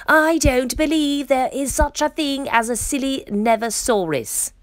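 high, defiant storybook shout. A narrator's reading. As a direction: declare it at a high pitch, emphatically. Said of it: "he shouted".

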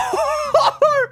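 high helpless laugh; burst of laughter. Real, not acted. Laughing at deep hole.

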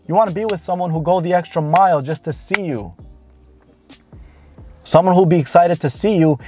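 ordinary talk

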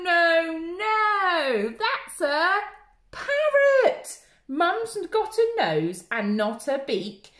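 lively storytime voice with gasps and slow rhymes; reading to children. Acted. Children's group leader reading Monkey Puzzle aloud, animated. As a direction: read it big for little ones, with wonder.

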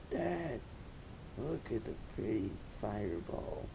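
very soft, small voice; quiet twins' odd first-words voice; damaged child voice. Acted. Young twins to their father on finally speaking, soft odd voice. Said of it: "why their voices sound like that".

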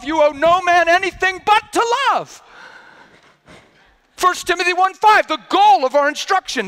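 fervent sermon climax, pitched high; impassioned preaching. Real, not acted. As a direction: preach it at full force, driving each point home. Preaches at full force.

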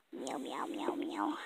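low, soft unvoiced meowing; whispered meows.

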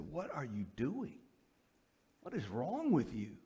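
soft, incredulous mock rebuke; hushed reproof. Men confronting him about his sin, hushed reproof. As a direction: imitate a mentor's quiet confrontation.

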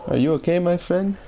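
light mock Indian accent, rising; a hint of an Indian accent, teasing. Teasing a buddy in a light Indian accent. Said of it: "I'm using the Indian accent".

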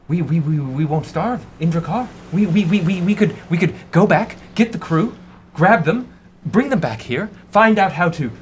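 high, stammering captain bubbling with hope; excited captain. Acted. Captain sharing a rescue plan with crew, high and excited.

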